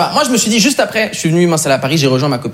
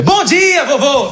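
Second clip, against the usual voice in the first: salutation très aiguë, joyeusement claironnée; salut enjoué; 11 semitones higher, much slower than usual. Acted. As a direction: lancer un bonjour surprise en portugais, haut perché. Réponse surprise au grand-père en portugais. (salut aigu et triomphant)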